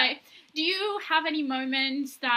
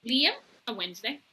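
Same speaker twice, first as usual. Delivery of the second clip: normal talking voice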